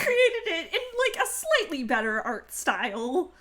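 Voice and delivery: high laughing, close to tears. Real, not acted. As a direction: laugh through the emotion. Tells a touching gift story, laughing.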